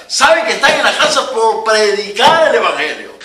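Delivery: preaching. Acted. Pastor urging the congregation in trouble, loud preaching.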